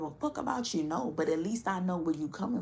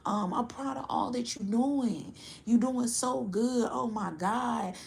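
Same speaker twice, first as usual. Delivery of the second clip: fake supportive fan; 5 semitones higher than usual. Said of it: "you got some people that's like".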